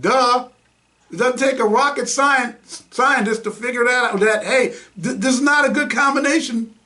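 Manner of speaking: high-pitched, incredulous sarcasm; mocking, as if it's painfully obvious; sarcastic duh. Real, not acted.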